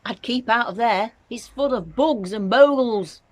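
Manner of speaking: George warning. A narrator's reading. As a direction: call up a worried warning.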